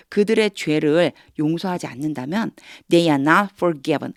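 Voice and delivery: ordinary talk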